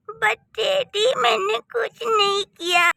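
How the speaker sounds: put-on sobbing child begging daddy; crying protest. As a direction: wail that you did nothing. Child protests being sent away.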